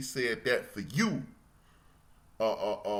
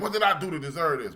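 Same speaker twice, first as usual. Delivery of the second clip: retold angry complaint; 7 semitones higher, faster than usual. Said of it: "'cause I'm like".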